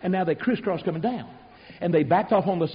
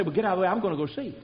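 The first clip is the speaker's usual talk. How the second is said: eager rush; much faster than usual. Retold or imitated. Men rushing off eagerly.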